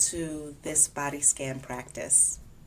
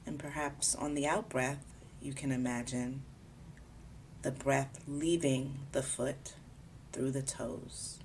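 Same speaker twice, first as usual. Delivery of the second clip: calm, unhurried body scan guidance; guided meditation; 5 dB quieter than usual. Acted. Guiding a listener through a body scan, calm meditation voice. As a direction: ease the listener gently from part to part.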